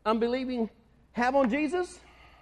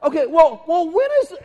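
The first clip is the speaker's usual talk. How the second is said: louder, higher, clueless follow-up; dim disciples; 7 semitones higher, 7 dB louder than usual. Retold or imitated. Disciples asking again, clueless. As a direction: blurt it missing the point. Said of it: "they go".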